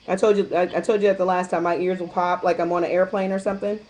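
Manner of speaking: normal talking voice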